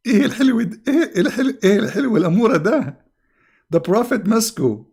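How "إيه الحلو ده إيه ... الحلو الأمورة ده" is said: put-on much higher, fond baby-talk voice; cooing. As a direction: coo over something adorable. Cooing over something cute.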